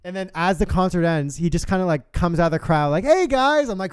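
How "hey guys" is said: high, breezy mock greeting; casual hey. Lost friend strolls out of the crowd, high carefree greeting. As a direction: play it like nothing happened.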